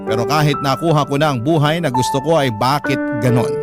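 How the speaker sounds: normal talking voice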